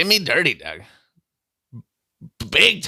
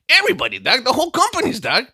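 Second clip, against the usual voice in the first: incredulous outburst; 10 semitones higher than usual. Retold or imitated. He tells her everyone knows.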